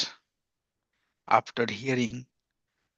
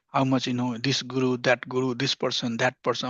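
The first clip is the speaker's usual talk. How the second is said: usual speaking voice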